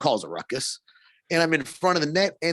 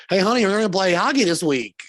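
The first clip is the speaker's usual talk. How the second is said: higher, louder, breezy dad voice; casual beer-league guy; 8 semitones higher, 5 dB louder than usual. Retold or imitated. Lightly voiced rec player to his wife about hockey, breezy. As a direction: toss it off like a husband heading out the door. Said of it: "the rest are just like".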